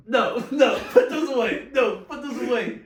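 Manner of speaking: loud, high, urgent no, put those away; urgent protest. Real, not acted. Streamer urgently telling friend to hide the props, loud. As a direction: hurry to stop someone showing the props. Hiding prop guns on stream.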